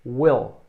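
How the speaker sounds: Will said with a short clipped i like "wel". Shown as a demonstration. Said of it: "Repete comigo".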